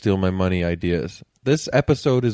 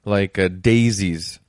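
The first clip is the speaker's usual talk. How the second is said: usual speaking voice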